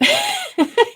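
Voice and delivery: a short high giggle at herself; light high-pitched laugh. Real, not acted. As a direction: giggle at your own silliness. Laughs at own silliness.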